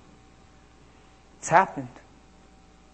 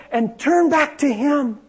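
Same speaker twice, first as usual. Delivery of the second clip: put-on higher and louder, earnest young woman; young woman's voice; 11 semitones higher, 5 dB louder, slower than usual. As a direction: speak as the girl delivering God's message. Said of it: "she said".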